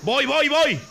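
high, loud urgent yell; urgent call. Real, not acted. Player answering a teammate, urgent shout.

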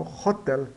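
"hotel" with the H like a Portuguese R, "hotél"; teacher modelling "hotel"; pronunciation demo. Shown as a demonstration. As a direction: breathe the H of "hotel" like the Brazilian R. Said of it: "ele tem o som do R em português".

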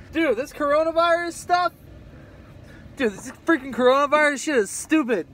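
loud, high, fed-up outburst; angry rant. Real, not acted. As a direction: vent your frustration loudly. Venting about wearing a mask.

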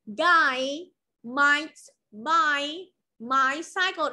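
That guy might buy my cycle said slowly; teacher models the last sentence for students; very slow model reading. Shown as a demonstration. Teacher models very slowly. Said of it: "sái cả quay hàm".

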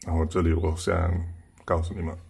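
usual speaking voice

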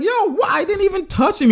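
high mock protest, pitched well up; imitating a protesting player. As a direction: play the innocent player pleading, pitch up high. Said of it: "He's like".

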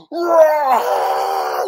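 loud, drawn-out roar; monster roar. Acted. Storyteller introducing the flying monster, roar. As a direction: bellow like an attacking beast.

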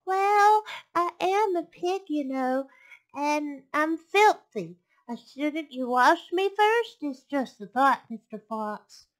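high, loud, coaxing piglet voice; wheedling piglet. Acted. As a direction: coax the fox as a clever piglet.

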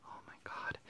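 awed whisper. Real, not acted. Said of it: "I'm very sorry if I'm talking really quietly".

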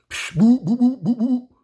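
high loud boop noises; imitated dribble sounds. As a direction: mimic the ball bouncing.

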